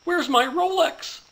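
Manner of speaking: high, loud, slow horrified cry; panicked shriek. Acted. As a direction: shriek it as the punchline.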